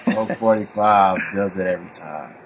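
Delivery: low beer-ad pitchman impression; Billy D Williams. Co-host does the old ad slogan, low impersonation. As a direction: drop low and deliver the slogan. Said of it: "my Billy D Williams voice".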